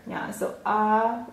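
usual speaking voice

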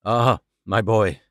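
slow, low, lightly voiced old father; a weary father sighing to his son; a touch of an anxious old man. A narrator's reading.

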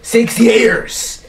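high, loud disbelieving cry; exasperated outburst. Real, not acted. Friend blurts out how long they've known each other, exasperated. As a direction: throw up your hands in disbelief.

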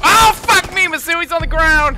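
loud, high panicked yell; frantic shout. Real, not acted. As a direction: yell the callout in alarm. Teammate down, yelling callout.

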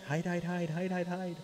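put-on playful urgent chant of a hiding toddler; high, repeated warning in a child's voice; hiding daughter. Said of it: "hide her head into her, and she'll just, like".

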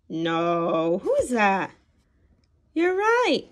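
ordinary delivery, nothing marked

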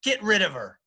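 high, loud command; yelled demand to the butler; shouted order. A narrator's reading. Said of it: "shouted Jane".

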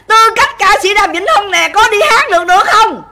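high, loud confrontational dare; angry challenge. Real, not acted. Livestream rant, challenging him to sue.

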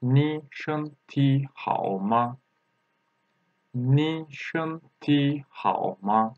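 slow syllables with space between them; slow repeat-after-me. Shown as a demonstration. Teacher asks learners to say with her, slow and measured. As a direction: lead learners through it word by word. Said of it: "say with me".

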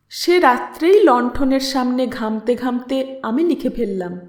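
ordinary delivery, nothing marked

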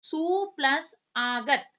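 teacher dictating each piece slowly; slow split. Shown as a demonstration. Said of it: "तो आप क्या करेंगे इसका".

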